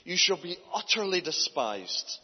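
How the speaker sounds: ordinary talk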